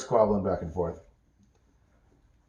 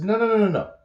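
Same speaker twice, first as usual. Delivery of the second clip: insistent protest; 9 semitones higher, 5 dB louder than usual. Acted. Brett insisting to Jill that they need the map back, high and emphatic.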